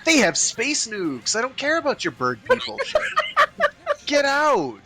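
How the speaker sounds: mocking high voice. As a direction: sneer them off, laughing. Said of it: "They're like".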